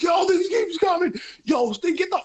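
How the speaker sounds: high-pitched frantic yelp; acted panic. Brother hiding under the seat from his pursuer, acted panic. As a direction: imitate a panicked brother begging to flee.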